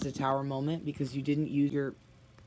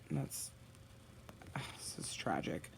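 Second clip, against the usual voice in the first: breathy whisper after a sigh; whispered dismay; 10 semitones lower, 11 dB quieter than usual. Real, not acted. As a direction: whisper it, heavy-hearted. Sighs over sad reading.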